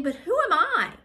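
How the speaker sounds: high, emphatic acted question; self-doubting protest. Moses making excuses to God, protesting in self-doubt.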